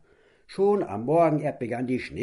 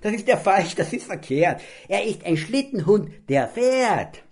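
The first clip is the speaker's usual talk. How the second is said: indignant loud rant; 10 semitones higher, 7 dB louder than usual. Acted. Borki keeps ranting indignantly.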